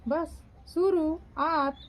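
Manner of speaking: बस शुरुआत spelled out slowly, stressing शुरुआत; slow corrective शुरुआत; slow correction. Shown as a demonstration. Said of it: "वात नहीं होगा".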